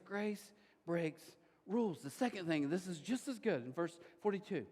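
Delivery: normal talking voice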